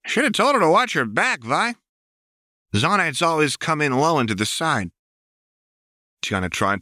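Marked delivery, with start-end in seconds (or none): 0.1-4.9 high, fast manic sneer; much higher, gloating fast; Jinx's gleeful taunt. Acted. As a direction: jeer at Vi like a gleeful maniac. Jinx taunts Vi over wounded Tiana.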